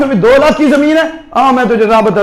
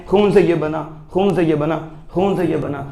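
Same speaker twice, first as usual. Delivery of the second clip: each repeat softer and lower, sinking to a near hush; fading repetition; 5 semitones lower, 7 dB quieter than usual. Real, not acted. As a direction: let the phrase die away three times.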